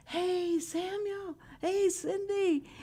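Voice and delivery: high, drawn-out sing-song call across a parking lot; calling out to grandkids. Retold or imitated. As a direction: call their names slow and high so everyone hears.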